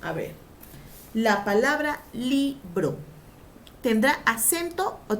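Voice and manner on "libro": libro slowly pulled apart as LI... BRO; split into syllables. Shown as a demonstration. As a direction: dictate libro in two separate beats. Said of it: "Si yo digo, por ejemplo, la palabra".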